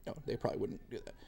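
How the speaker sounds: "No, they probably wouldn't do that" as a fast unvoiced whisper to himself; muttered aside. Real, not acted. Teacher correcting himself in a whispered aside.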